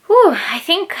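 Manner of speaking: high, loud exhale of relief before answering; whew. Real, not acted. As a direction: blow out a big whew at the tough question. Reacting to a hard question.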